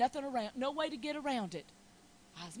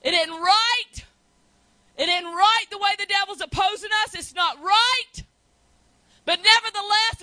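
loud, higher cry to God; loud pleading prayer; 9 semitones higher, 15 dB louder than usual. Retold or imitated. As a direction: press the Lord hard, shouting. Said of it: "I said".

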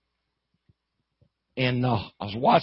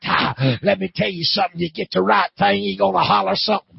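high, loud revival preacher; pitched-up preaching cadence; fired-up preaching; 6 semitones higher, 5 dB louder than usual. Real, not acted. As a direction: exhort the congregation with force. Preaches at full force.

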